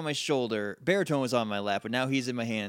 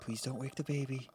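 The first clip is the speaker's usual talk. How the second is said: hushed plea; 8 dB quieter than usual. Acted.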